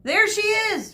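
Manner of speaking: shouting. A narrator's reading. Boy shouting to everyone that Medusa has arrived. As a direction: shout and point. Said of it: "Shirin shouted".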